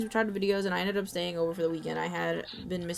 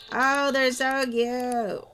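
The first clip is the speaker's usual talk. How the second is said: cooing; 6 semitones higher, 6 dB louder, slower than usual. Real, not acted. Cooing over cats.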